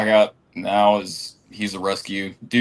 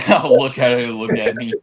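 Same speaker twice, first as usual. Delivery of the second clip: laughing; 2 semitones higher, 4 dB louder than usual. Real, not acted. Echoing a friend while laughing.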